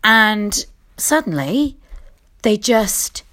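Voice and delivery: ordinary talk, nothing marked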